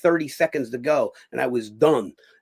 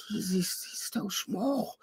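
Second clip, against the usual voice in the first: soft, slow, half-whispered jab about his size; quiet sneering murmur; hushed mocking aside; 5 semitones higher, 10 dB quieter, slower than usual. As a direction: drop the voice and drag out so small. Host mocking his size on air.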